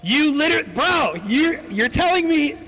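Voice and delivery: very high, loud disbelief; exasperated outburst. Retold or imitated. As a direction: squeal it in exaggerated outrage at a friend. Retold outraged reply in the argument.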